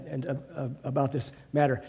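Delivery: ordinary talk, nothing marked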